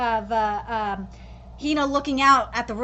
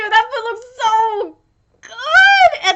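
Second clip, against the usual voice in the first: laughing craving; 10 semitones higher, 12 dB louder, much slower than usual. Real, not acted. Craving the food on screen.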